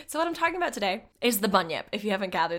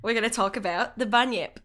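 hammed-up Australian accent; 3 semitones higher than usual. Host leading listeners to the outback, hammy Australian accent. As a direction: do a broad, mock Aussie accent. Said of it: "I'm gonna do an offensive accent today".

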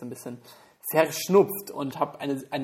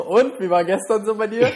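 higher, louder, laughing shout; mock shout over music; 7 semitones higher, 9 dB louder than usual. Friend imagines him shouting into someone's ear at a concert, mock yell. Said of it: "die ganze Zeit ins Ohr geschrien".

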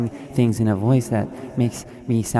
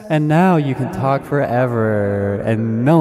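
guru hype climax; 10 semitones higher, 5 dB louder than usual. Acted. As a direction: stretch best success like a stadium chant.

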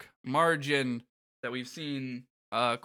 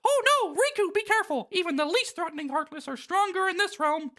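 Mickey Mouse voice. Acted. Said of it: "Mickey says".

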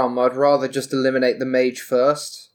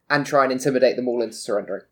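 usual speaking voice

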